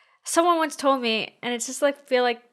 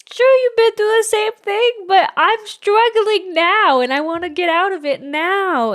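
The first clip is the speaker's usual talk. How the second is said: much higher and louder, exasperated; a near-yell of frustration at mom; frustrated outburst; 8 semitones higher, 8 dB louder, slower than usual. Retold or imitated. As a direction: throw the question back at mom, exasperated. Retold outburst at her mom.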